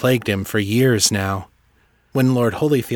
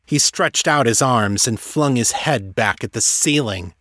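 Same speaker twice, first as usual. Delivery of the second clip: plain reading voice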